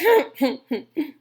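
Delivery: short chuckle slipping out; small laugh. A narrator's reading. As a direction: let a little laugh escape. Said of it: "I think he laughed a little".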